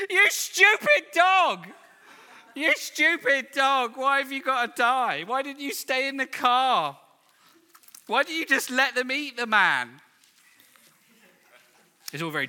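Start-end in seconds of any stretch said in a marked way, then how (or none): 0.1-10.0 tearful shouting. Retold or imitated. Daughter shouts at the TV as the dog dies, hammed-up tearful yelling. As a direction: imitate an inconsolable daughter yelling at the TV. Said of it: "she was shouting at the T V".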